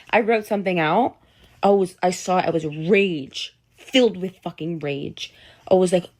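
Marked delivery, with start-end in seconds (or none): none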